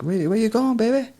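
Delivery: high teasing imitation of her; mock flirty call. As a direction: pitch way up, luring him over. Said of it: "and she's like".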